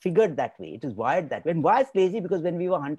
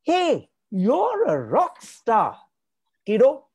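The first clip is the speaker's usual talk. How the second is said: gushing parent praise; 8 semitones higher than usual. Acted. Parent showering a child with praise for a painting, gushing. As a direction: rave about the kid's painting like a proud parent. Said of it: "What does a child ask for a reward?".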